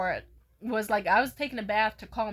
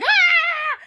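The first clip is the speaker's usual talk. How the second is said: very high, loud sustained shriek; operatic scream; 11 dB louder than usual. Retold or imitated. Screaming at the peeping Tom, operatic high-note shriek. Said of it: "high notes like I was in the opera".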